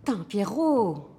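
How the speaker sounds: high, shaken reproach; startled fright. Acted. Club owner to the man who scared her, startled. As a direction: scold him as the scare drains away. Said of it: "tu m'as foutu une de ces trouilles".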